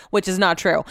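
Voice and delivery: usual speaking voice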